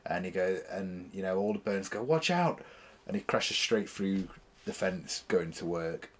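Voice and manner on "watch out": higher-pitched retold warning; half-shouted warning; mock warning shout. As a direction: call out a quick mock alarm.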